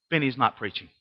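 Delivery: low, soft deadpan; dry aside. Real, not acted. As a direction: drop it as a quiet punchline. Dry punchline about low turnout.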